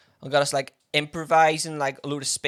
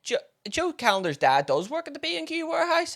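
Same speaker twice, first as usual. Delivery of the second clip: higher, triumphant mum reveal; lightly voiced mum who knows the dad; knowing mum; 9 semitones higher than usual. Imitating mum recognising the teacher's father, knowingly. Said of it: "And she was like".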